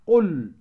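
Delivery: "قل" with a held, clearly sounded final l; clear lam. Shown as a demonstration. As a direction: linger on the l of "قل". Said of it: "أظهرت اللام".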